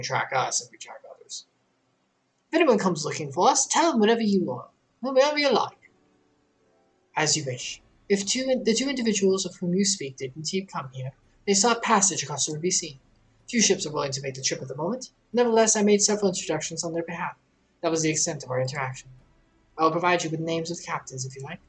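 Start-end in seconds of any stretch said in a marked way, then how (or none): 2.5-5.9 higher, breezy "whatever"; a traveler waving off the official's warning; offhand permission. Acted. Voices the player character. 7.2-21.6 lightly voiced formal stateswoman, calm and deliberate; an island official lecturing foreign visitors; measured official.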